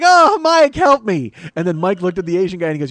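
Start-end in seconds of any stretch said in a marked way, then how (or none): 0.0-1.3 hammed-up high, loud cry for help; mock pleading cry. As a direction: squeal for rescue like a cartoon victim. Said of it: "And Davy Jones was like".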